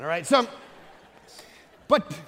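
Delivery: ordinary talk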